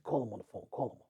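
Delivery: very soft, low murmur; retold whisper. Fifty secretly tells his friend to phone Mark, whispered. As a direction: give the instruction secretly, barely voiced. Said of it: "he whispers to me".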